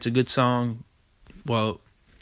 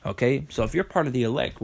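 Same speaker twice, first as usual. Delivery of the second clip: normal talking voice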